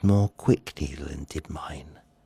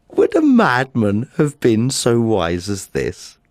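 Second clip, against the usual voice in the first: laughing, then slowing down; gleeful laugh; 3 semitones higher than usual. Acted. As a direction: gloat with a giggle. Gloating over his cunning.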